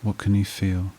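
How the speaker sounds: even, level meditation voice with long pauses; guided meditation. Acted. Therapist guiding listeners back through a painful memory, guided meditation voice. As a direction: guide the listener steadily, leaving long silences between prompts.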